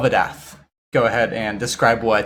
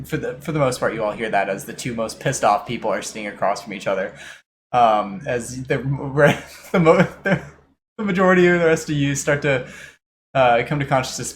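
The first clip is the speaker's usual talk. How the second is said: ordinary delivery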